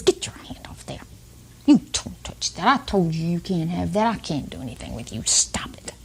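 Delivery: imitating a mother's harsh, half-whispered telling-off; hushed scolding. Imitating mothers snapping at kids in the grocery store, hushed scolding. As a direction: scold under your breath, dropping to a whisper.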